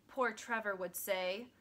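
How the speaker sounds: plain reading voice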